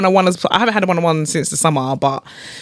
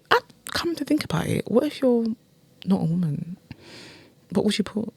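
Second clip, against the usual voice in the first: soft, half-voiced aside; quiet aside; 10 dB quieter than usual. Real, not acted. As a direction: drop it to a murmur. Aside, much softer.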